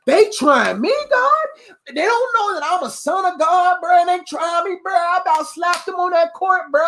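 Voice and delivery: high, worked-up ranting to God; venting to God. Acted. Preacher acts out talking crazy to God after being tried, indignant venting. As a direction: let the indignation climb into a high-pitched rant. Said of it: "I'll be talking crazy to God".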